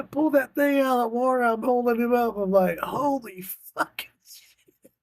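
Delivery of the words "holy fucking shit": slow, awed oath; amazed. Retold or imitated. Grandpa holding up the big bass, awed. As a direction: savor the catch in disbelief.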